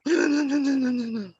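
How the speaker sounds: high, loud frantic no's; frantic, high-pitched refusal; panicked no. Retold or imitated. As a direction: imitate a panicked woman in shock. Panicked crash victim retold.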